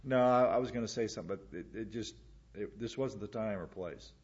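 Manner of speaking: soft, faltering, trailing away; hesitant inner voice. Retold or imitated. Believer explaining a stifled moment, soft faltering voice. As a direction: let it fade as if stifled. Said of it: "there is a situation where".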